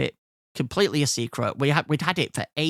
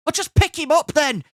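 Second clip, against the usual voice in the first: high, raised exasperated outburst; losing his temper; 12 semitones higher, 4 dB louder than usual. Retold or imitated. Inspector losing it with the dog's owner, exasperated. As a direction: fling it out as an angry order. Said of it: "the inspector just lost it".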